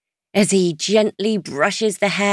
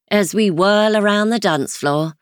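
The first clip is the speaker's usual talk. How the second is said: ordinary delivery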